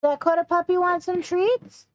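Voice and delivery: high, slow sing-song puppy talk; talking to a dog. Real, not acted.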